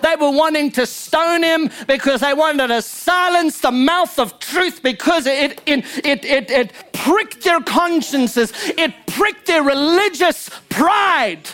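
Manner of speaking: high, emphatic pulpit delivery; impassioned preaching. Real, not acted. Preacher driving a point home to the congregation, impassioned. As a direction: preach it hard, pitch riding up.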